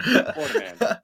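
said high through a laugh. Real, not acted. Repeating the running joke, laughing.

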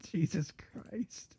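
slow exasperated whisper; whispered curse. Real, not acted. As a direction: breathe it out under your breath. Exasperated under breath.

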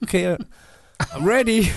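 high, chirpy announcement; light mock-sweet voice; cheery girl. Imitating the instructor calling from the top, high and chirpy.

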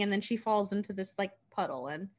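ordinary delivery, nothing marked

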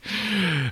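squeaky amused laugh; high giggle. Real, not acted. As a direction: giggle at yourself. Laughs at own demand.

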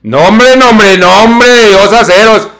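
loud high put-on voice, slowed at the end; Michi voice. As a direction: shout it in a high cartoonish voice. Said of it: "Así con voz de Michi".

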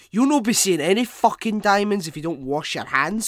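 loud, high, sweary warning; mock angry scolding. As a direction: scold like an exasperated hygiene fanatic. Acted scolding rant.

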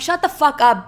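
loud, high-pitched shut-down; retold angry snap. Telling off her nosy date over the cash, angrily.

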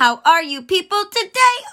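greeting sung out way up high; really high voice. Acted. Host greeting listeners, very high and loud. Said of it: "Oh man, that's really high".

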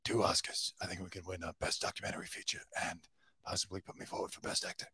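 whispered, quick conspiratorial mock Alec; mock scheming whisper. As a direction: hush it like a secret plan, fast. Imitating Alec's private documentary ambitions.

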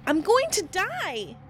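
high, loud and slow, sure she is dying; terrified. Retold or imitated. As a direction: wail it out in terror.

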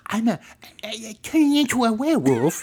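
high, loud goofy Sandler voice; Sandler werewolf voice. Pretend. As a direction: Sandler turned werewolf, laughing. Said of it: "Adam Sandler meets the Wolfman".

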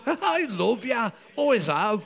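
put-on Braveheart Scottish accent. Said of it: "you are doing the Braveheart accent".

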